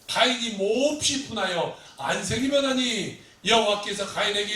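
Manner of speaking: loud, measured Bible reading; emphatic pulpit reading with drawn-out words; scripture reading. Acted. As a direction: proclaim the verse slowly and forcefully. Reads the scripture passage aloud.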